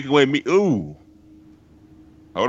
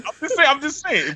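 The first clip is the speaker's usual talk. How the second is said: laughing; 13 semitones higher than usual. Real, not acted. Laughing while teasing.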